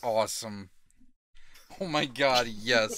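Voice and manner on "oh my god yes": loud, thrilled; excited cheering. Acted. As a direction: celebrate joining. Mock celebration.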